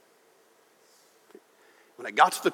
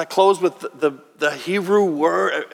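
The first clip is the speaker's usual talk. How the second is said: ordinary delivery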